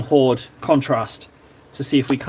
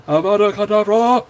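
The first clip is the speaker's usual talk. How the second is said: a put-on curse, pitched a little higher, steadier; closer Voldemort; 5 semitones higher than usual. Third go at the villain curse, a hint of a dark wizard. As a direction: try the villain curse again, more controlled. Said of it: "That's a little closer".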